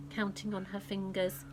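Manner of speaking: ordinary delivery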